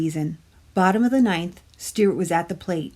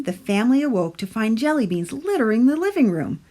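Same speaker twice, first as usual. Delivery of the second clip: ordinary delivery, nothing marked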